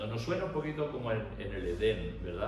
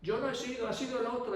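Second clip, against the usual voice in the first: put-on high-pitched child's voice; whiny child; 13 semitones higher than usual. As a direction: play a child blaming another kid. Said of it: "los niños que juegan, corren, pelean, gritan".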